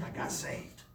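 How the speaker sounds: hushed, whispered words; whispered confession. Retold or imitated. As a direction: breathe it out as a secret. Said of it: "I said".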